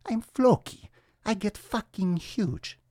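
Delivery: hammed-up Viking trickster; Floki voice. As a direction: play the wild Viking shipbuilder bragging. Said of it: "the show Vikings".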